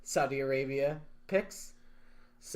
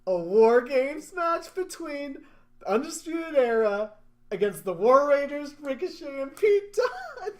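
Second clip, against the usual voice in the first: match announcement; 11 semitones higher, 6 dB louder than usual. Acted. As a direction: proclaim the match line-up to the arena.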